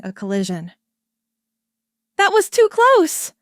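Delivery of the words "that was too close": high, loud, rattled snap; angry snap. A narrator's reading. Ayala snapping at pilot after near collision, loud and high. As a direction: bark it out after a near miss. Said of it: "Ayala snapped".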